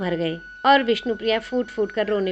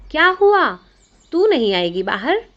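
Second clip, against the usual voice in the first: much higher, louder call; 6 semitones higher, 7 dB louder than usual. A narrator's reading. Friend calling Vishnupriya out of the Ganga, loud high call. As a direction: call to her across the water.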